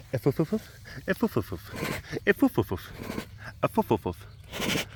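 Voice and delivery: rapid sniffing puffs aimed at the pet; playful snuffle sounds; snuffling noises. Real, not acted. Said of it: "I have to make sure to snuffle at you".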